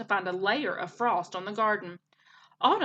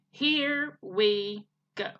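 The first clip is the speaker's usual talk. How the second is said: slow, hesitant; much slower than usual. Acted. Gosling before switching robot off.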